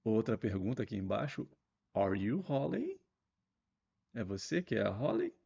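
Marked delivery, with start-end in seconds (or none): 1.9-3.0 slow question, the tune going up; question intonation. Shown as a demonstration. Teacher models a question to the class slowly. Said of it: "a entonação de voz também muda".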